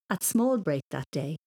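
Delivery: usual speaking voice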